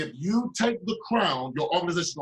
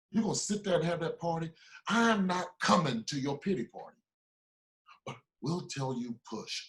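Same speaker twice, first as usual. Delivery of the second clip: ordinary delivery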